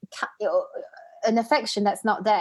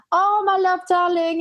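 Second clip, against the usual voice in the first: higher, louder, gushing mum voice; doting Welsh mum; 9 semitones higher, 4 dB louder than usual. Retold or imitated. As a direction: imitate a doting mother, lifting the voice. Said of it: "his mom kept saying".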